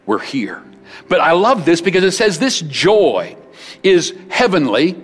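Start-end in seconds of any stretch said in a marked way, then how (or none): 1.1-4.9 loud, high, driving sermon voice; fiery preaching. Acted. Pastor preaching joy to the congregation, loud and driving. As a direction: preach it out with full force.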